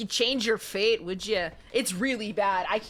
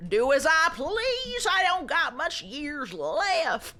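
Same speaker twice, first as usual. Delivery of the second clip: high, slow, sassy meemaw voice; mock old granny; 6 semitones higher, slower than usual. Stubborn old grandma answering back, high slow meemaw voice.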